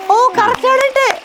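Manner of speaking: very high, impressed cooing; delighted praise. Real, not acted. Interviewer to the boy who got it right, delighted. Praising the boy's successful attempt.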